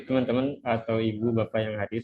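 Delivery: ordinary delivery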